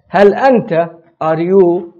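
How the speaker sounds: ordinary delivery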